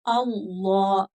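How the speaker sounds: अल्लाह drawn out with a dark "llaw"; heavy lam. Shown as a demonstration. Teacher models the thick lam, slow full अल्लाह. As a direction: stretch and round अल्लाह into "allaw". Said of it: "मोटा होगा".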